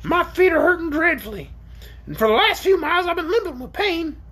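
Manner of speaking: high, shrill, pained creature voice; groaning. A narrator's reading. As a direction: complain of sore feet in a piercing orc voice. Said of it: "asserted the orc with a groan".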